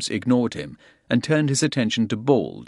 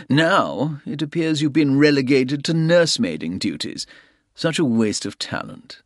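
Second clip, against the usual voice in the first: higher, louder mocking; 7 semitones higher, 3 dB louder than usual. A narrator's reading. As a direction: rub the demotion in. Soames mocks Bald.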